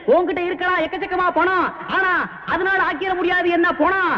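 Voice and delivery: high, sing-song mocking rhymes; gloating comic jingle; rhyming taunt. Rhyming taunt behind rival's back.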